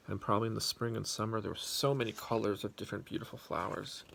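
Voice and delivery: usual speaking voice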